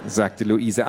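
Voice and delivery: usual speaking voice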